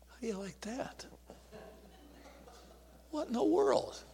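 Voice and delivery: hushed, near-whispered disbelief; stunned disbelief. Retold or imitated. Young man stunned after being brushed off, hushed disbelief. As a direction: say it under the breath, stunned.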